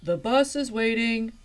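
loud, high teacher's call. Acted. Teacher calling Velma to the bus. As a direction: call it out across the room. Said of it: "her teacher called".

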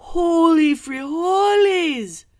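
very high, loud, slow shriek; shrieked exclamation. A narrator's reading. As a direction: scream it in shock. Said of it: "cried Skipito".

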